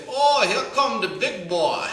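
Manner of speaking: put-on big, loud Gullah accent; Troy. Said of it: "that's the Gullah accent right there".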